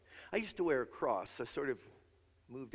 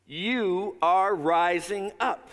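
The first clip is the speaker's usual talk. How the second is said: much louder, slow, each word drawn out for the crowd; loud slow proclamation; 7 semitones higher, 13 dB louder, much slower than usual. Shown as a demonstration. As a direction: lead the congregation in it at full voice. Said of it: "Will you say that with me".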